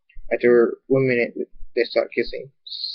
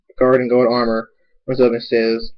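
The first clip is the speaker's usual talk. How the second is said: ordinary delivery, nothing marked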